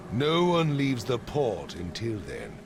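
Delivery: commanding. Acted. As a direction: bark the order. Widow orders port closed.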